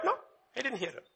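quick correction. Real, not acted. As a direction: catch yourself mid-line. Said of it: "correcting himself".

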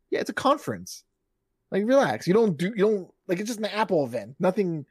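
mildly exasperated, talking the hype down. Retold or imitated. Host telling an overblown trailer to relax, exasperated.

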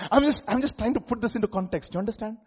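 ordinary talk, nothing marked

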